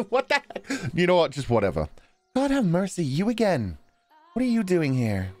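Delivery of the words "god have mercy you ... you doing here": put-on higher, livelier British boy; a cheeky British lad. Boy teasing Pauline at the shore, British accent. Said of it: "he's going British".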